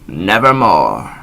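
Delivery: slow, loud, final croak; final raven croak. Acted. Raven's last answer to the narrator, slow heavy croak. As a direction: let the last word fall like a stone. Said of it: "Quoth the raven".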